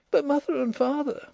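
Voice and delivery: put-on very high girlish voice, objecting; girl's protest. A narrator's reading. Nellie worrying about her parents, high protesting voice. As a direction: pitch it right up as an anxious objection.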